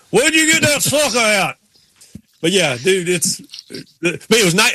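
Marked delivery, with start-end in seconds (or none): 0.1-1.6 crank the holler up; Ward Burton impression. Retelling Ward Burton's line to the cohost, an even bigger country holler. Repeating the quote even higher.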